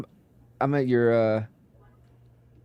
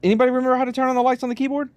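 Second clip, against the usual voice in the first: frantic; 12 semitones higher, faster than usual. Real, not acted. Rushing to find lights.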